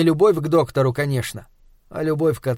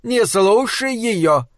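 jovial; 7 semitones higher, much slower than usual. Acted. Jovial aside.

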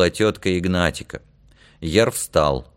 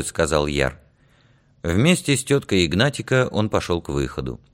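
ordinary delivery

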